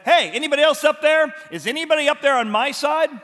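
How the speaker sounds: loud, high shout up to the window; calling up. Acted. Jehu shouting up to the eunuchs at the window, loud call. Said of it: "looks up at the window and said".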